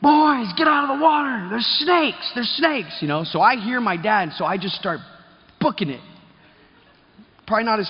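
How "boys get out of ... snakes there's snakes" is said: urgent fatherly holler; dad yelling a warning. Retold or imitated. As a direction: call the boys out of the water in alarm. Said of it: "my dad's like".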